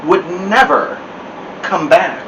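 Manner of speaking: plain reading voice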